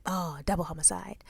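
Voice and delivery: soft, low deadpan quip; muttered aside. Retold or imitated. Mom reacting to a kid's dead phone, muttered aside. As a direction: let it slip under your breath. Teller blurting the meme in car.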